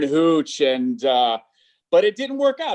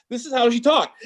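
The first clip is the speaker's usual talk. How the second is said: usual speaking voice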